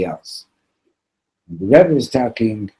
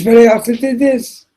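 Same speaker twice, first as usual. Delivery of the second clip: much higher and louder, pleading; desperate confession; 9 dB louder than usual. Retold or imitated. Preacher confessing to the axe man, high frantic pleading. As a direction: plead your sins in a panic.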